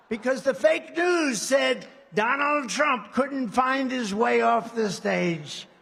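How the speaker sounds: slow, loud, booming mock newsreader; slow, loud sneering mock news report; mock news anchor. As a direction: announce it like a pompous scandal headline. Mocking fake news report.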